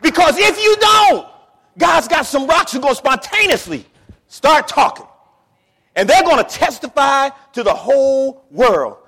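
high, loud preacher's proclamation; full-force preaching. Acted. Preacher urging the congregation to testify, high full-force shout. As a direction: cry it out to the congregation.

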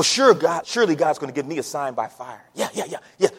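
normal talking voice

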